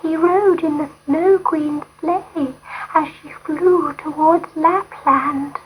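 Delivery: little dove sharing news; cooing dove. A narrator's reading. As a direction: coo it like a gentle dove. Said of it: "one of the doves said".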